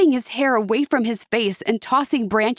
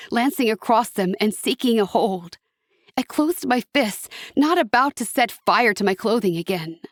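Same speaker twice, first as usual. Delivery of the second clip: usual speaking voice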